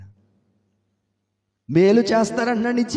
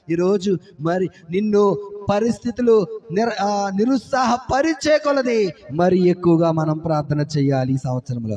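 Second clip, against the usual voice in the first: normal talking voice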